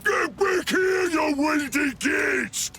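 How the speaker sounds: high, drawn-out furious holler; slow, high-pitched threat shouted after them; angry chasing yell. Acted. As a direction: yell after someone running away. Chasing fleeing underling in anger.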